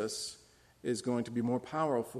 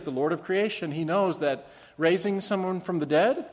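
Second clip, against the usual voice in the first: normal talking voice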